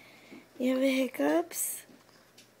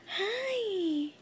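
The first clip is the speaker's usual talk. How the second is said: ordinary talk, nothing marked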